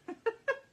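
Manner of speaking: high, loud burst of real laughter. Real, not acted. Paper crafter cracking up at a sloth doing yoga, bursting into laughter. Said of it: "I was just cracking myself up".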